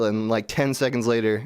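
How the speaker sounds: ordinary talk, nothing marked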